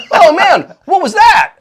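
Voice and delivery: hammed-up shocked boy; startled revived kid. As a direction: gasp it out like you just woke from death.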